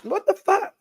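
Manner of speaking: high, soft surprised outburst; startled high exclamation. Real, not acted. Vlogger startled by her stuffy nose, high surprised outburst. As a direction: react to your nose going weird.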